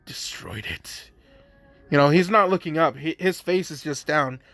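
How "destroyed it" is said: much softer, slow, whispered reply; quiet cold reply. Acted. Deku answers Redestro without looking up, in a slow soft whisper. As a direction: let it drop low and quiet, barely voiced. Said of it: "Deku's just like".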